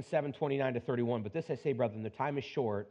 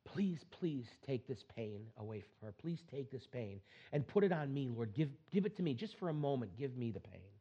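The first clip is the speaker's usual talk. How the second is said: breathy, hushed desperate prayer, much softer; whispered plea; 8 dB quieter than usual. Retold or imitated. Son praying beside his mother's sickbed, whispered pleading. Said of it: "And he cried out to the Lord".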